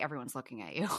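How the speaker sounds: put-on a mother hushing her kids in public; hushed warning.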